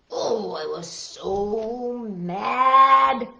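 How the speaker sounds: very slow, drawn-out mock anger; storybook grumpy. A narrator's reading. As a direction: stretch "so mad" into a hammed-up grumble. Book child grumbles in anger.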